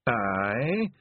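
plain reading voice

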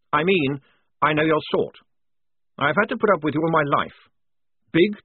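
defiant dressing-down, rising in pitch; indignant tirade. Acted. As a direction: build to righteous outrage at the bully. Lieutenant rounds on the enemy captain.

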